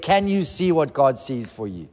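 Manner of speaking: ordinary delivery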